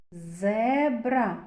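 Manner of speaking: зебра with a long buzzing z at the start; drawn-out first sound. Shown as a demonstration. Teacher picking out the first sound for children, зебра with held z. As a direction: stretch the opening z of зебра. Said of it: "Перший звук з".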